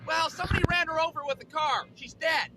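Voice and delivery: high, loud lady neighbor voice. Acted. Neighbor telling boy about the cat, high voice. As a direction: break the news in a high voice. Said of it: "the neighbor lady comes up to me".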